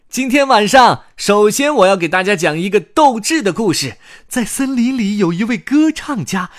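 put-on high, loud showman child voice; boy storyteller on stage. Young storyteller greets the audience, high loud presenting. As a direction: present it grandly, slowing towards the title.

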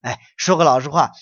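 ordinary delivery

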